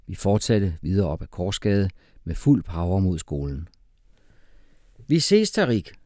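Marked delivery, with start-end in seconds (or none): 5.1-5.9 high, loud goodbye shout; called out. A narrator's reading. Boy to brother on the way to school, calling out. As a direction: call it out high to someone leaving. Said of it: "råbte jeg".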